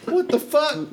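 very high cursing character voice; irate halfling woman. Acted. Halfling woman cursing from behind the party, high and irate. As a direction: curse in a high, put-on squawk. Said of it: "And she's like".

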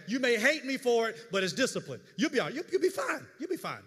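loud, high insistent preaching; preaching force. Real, not acted. Pastor telling the flock they will survive, loud insistent preaching. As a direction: drive home the tough love, loud and high.

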